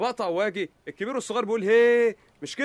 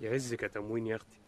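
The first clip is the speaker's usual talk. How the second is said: mock sweet blessing; 9 semitones lower, 9 dB quieter than usual. Mocking the ration card again.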